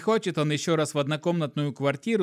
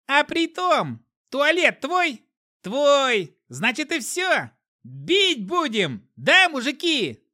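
high, loud squealing rabble-rouser; shrill runt yelling; 10 semitones higher, 5 dB louder, much slower than usual. A narrator's reading. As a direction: screech to rile the crowd. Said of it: "крикнул из толпы заморыш".

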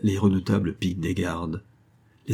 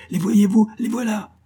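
very high, excited shout; piercing call to the crowd; crying out; 13 semitones higher, 4 dB louder than usual. A narrator's reading. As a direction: point them out in a high cry. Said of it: "criait Friquet".

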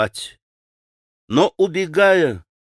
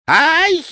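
put-on high old woman's shriek; sharp, high-pitched yelp of fright; закричала; 4 dB louder than usual. A narrator's reading. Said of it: "закричала старушонка". (пронзительный вскрик старушонки)

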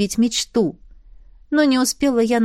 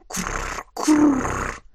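soft little dragon purr; quiet dragon coo; 5 semitones higher, 3 dB quieter than usual. A narrator's reading. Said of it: "тихонько добавила Айна". (тихое драконье курлыканье)

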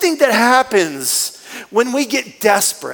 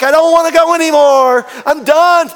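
loud, higher, whiny give-up; mock exhausted protest; 7 semitones higher, 7 dB louder than usual. Worn-out patient begging the staff to stop, loud and high. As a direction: beg to stop, hamming it up. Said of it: "And you're like".

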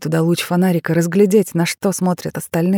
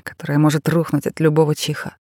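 usual speaking voice

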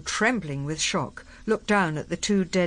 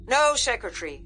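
higher, barked retort; 8 semitones higher than usual. A narrator's reading. General answering the secretary over the radio, barking. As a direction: bark it back over the radio. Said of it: "barked Cutler".

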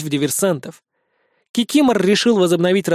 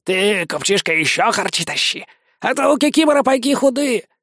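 higher toward the end, cheeky demands; cheeky vampire; 6 semitones higher than usual. A narrator's reading. Vampire demands more grub from the merchant, cheeky. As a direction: demand more food, playing hard done by.

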